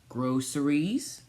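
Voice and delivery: ordinary delivery, nothing marked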